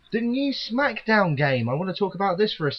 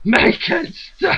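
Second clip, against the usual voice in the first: slow, high, wailing mock cry; mock crying shout; 10 semitones higher, 4 dB louder, much slower than usual. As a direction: wail it like a hammed-up tantrum. Echoing the mock despair.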